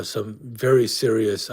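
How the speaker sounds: ordinary talk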